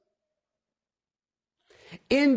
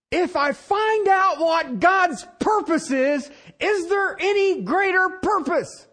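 fervent preaching shout; 7 semitones higher than usual. Real, not acted. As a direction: drive it home like a pulpit climax. Preacher rising to a peak.

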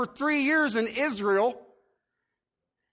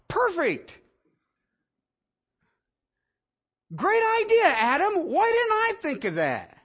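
sarcastic; 7 semitones higher than usual. Real, not acted. Preacher mocking Adam's plan with sarcasm. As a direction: sneer it as fake praise.

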